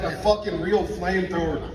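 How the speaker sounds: usual speaking voice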